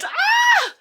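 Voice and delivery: high, drawn-out wail; pained cry. Real, not acted. Vlogger moaning about her dead arm, high pained cry.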